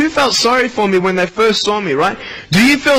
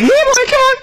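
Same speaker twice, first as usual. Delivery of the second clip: very high, coaxing pet call; silly high dog-calling voice. Pretend. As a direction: call the phone like a puppy, squeaky and eager. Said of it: "say to the phone".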